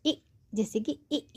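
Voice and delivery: ई said short and clipped, a quick short vowel; छोटी ई; short ee sound. Shown as a demonstration. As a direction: give ई as a brief short sound. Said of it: "वो छोटी sound होती है".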